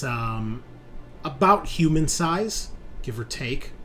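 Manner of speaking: slow, suspenseful narration with long pauses; game master scene setting. Acted. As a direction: set the scene word by word, letting key words ring. Game master describes the creature's arrival.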